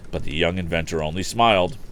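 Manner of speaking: usual speaking voice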